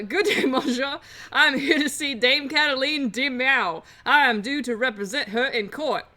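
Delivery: lightly hammed New Orleans gentleman; formal greeting with a put-on drawl; courteous lawyer Falcon. Lawyer introducing himself to a prison guard, lightly grand.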